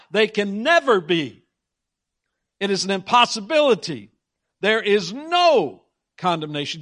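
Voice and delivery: "never", "impossibility" and "no" hit hard; emphatic preaching, punching "never" and "no"; intense emphasis. Real, not acted. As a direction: drive home "no" with force. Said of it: "Do you see the intensity?".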